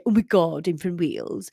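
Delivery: lightly voiced Welsh teenager; a hint of Welsh teen; teenage Welsh. Narrator practises the son's voice, teenage Welsh. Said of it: "like, teenage Welsh".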